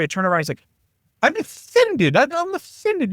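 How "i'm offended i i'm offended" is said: high, put-on German accent voice; hammed-up offended German accent. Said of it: "Still working on my German accent".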